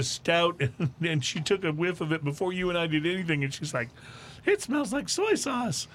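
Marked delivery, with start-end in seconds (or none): 4.5-5.9 high-pitched, slow mock quote; imitating a guest. Host retells a guest sniffing old stout, high-pitched mimicry. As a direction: mimic her reaction in a high, drawn-out voice.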